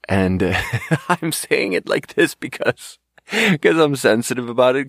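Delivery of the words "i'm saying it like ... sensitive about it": real laugh running through higher-pitched words; laughing while talking. Real, not acted. Speaker confessing he is sensitive about it, laughing. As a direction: explain through your own giggles.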